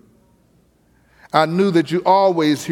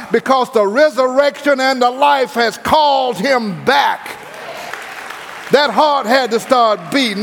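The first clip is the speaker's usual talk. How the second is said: preaching at full force; 5 semitones higher than usual. Acted. Preacher to the congregation, rising fervent preaching. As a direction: preach it up to the peak.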